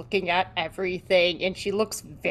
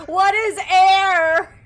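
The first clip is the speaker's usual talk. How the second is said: high, loud and drawn out; hammed-up philosophical bellow; mock existential cry; 11 semitones higher, 10 dB louder, much slower than usual. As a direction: wail the question with comic grandeur. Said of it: "it's just like".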